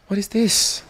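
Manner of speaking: high, slow astonished question; gasp, then marvel at it; a real gasp of wonder; gasping surprise. Real, not acted. Gasp at something in game.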